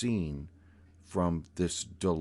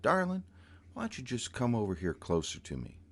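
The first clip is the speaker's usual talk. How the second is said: higher, lilting coaxing voice; sweet lilting voice; 3 semitones higher, 3 dB quieter, faster than usual. Retold or imitated. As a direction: coax sweetly, sing-song. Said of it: "this beautiful, lilting voice".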